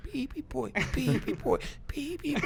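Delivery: put-on very high baby-boy call; from another room. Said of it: "You hear from the other room".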